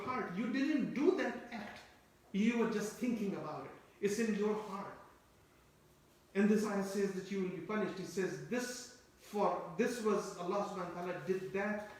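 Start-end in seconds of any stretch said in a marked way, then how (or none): none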